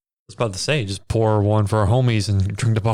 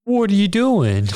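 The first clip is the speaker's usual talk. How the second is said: high, drawn-out panicked cry; hammed-up scream-queen question; mock alarm; 11 semitones higher, 3 dB louder, slower than usual. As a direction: imitate a horrified victim calling out. Voicing Karen fleeing Todd's attack.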